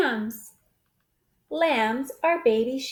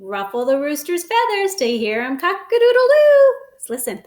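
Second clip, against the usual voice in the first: ordinary delivery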